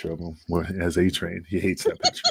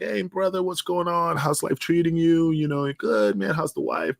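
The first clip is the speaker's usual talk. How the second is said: much higher, cheery hey-brother greeting; upbeat greeting; 10 semitones higher than usual. Retold or imitated. Greeting the manager at the gym, much higher and cheery. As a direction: bounce up bright to greet a buddy.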